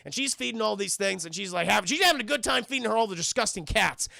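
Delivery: usual speaking voice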